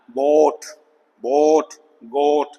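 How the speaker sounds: "बोट, गोट" with a drawn-out O diphthong. Shown as a demonstration. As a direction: glide through the O in "बोट, गोट". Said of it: "यहाँ ये diphthong है".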